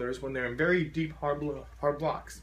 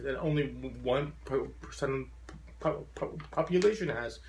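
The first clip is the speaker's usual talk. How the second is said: halting stutter on the P sounds. Real, not acted. Speaker addressing fellow stutterers, stuttering. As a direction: catch repeatedly on the first syllable before finishing. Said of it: "as someone with a very profound stutter".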